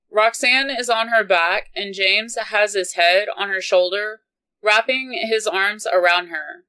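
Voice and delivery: usual speaking voice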